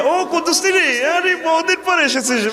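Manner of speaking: hammed-up flirty wife welcoming a visitor; mock sweet woman. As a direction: coo like a flirty wife greeting a man. Sweet welcome to other man.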